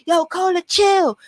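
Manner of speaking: friends pleading. Retold or imitated. Friends pleading to stop stabbing.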